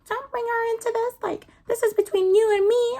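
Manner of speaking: high, mocking girly voice; mocking the friends. Teen girl mocks her rival's friends, high mocking voice. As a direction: mimic the mean girls in a high sneer. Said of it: "her friends are like".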